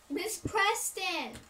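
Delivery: mock yell, high and drawn out; imitated kid's holler for help; retold scream. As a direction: call slowly for the teacher. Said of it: "and so he screamed".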